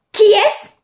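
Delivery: loud high catty voices; shrill mocking mermaids. Reenacting mermaids loudly. (sirènes moqueuses criardes)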